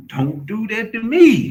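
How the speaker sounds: high, slow, loud whine; hammed-up high whine; drawn-out high complaint; mock whiny plea.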